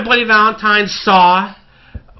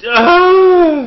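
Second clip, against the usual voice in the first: frustrated cry; 10 semitones higher, 6 dB louder than usual. Real, not acted. Bursting in frustration.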